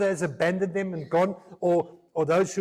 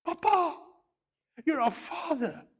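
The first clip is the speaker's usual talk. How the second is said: put-on high childlike voice, tender; a little daughter saying "Papa" up high; child calling papa; 9 semitones higher than usual. Child voiced talking to father.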